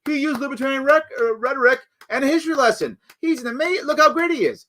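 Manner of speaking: high, mock-admiring libertarian voice; gushing libertarian. Libertarians praising their own rhetoric, in a high mock-gushing voice. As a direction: fawn over him in a high, mocking tone. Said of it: "and libertarians go".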